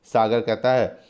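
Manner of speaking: ordinary talk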